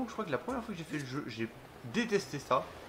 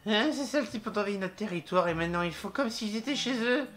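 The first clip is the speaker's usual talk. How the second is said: put-on higher, grumbling Italian-accented game character; mock Italian accent; 5 semitones higher, 3 dB louder than usual. Game creature moans about invaders, mock Italian accent. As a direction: complain in a hammed-up Italian accent. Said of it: "pourquoi est-ce que je prononce un accent italien".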